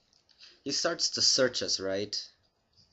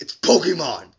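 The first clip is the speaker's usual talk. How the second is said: "Pokemon" shouted, much louder and higher; furious correction; 6 semitones higher, 14 dB louder than usual. Retold or imitated. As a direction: yell the proper name "Pokemon" back at him. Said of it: "I wanted to punch him".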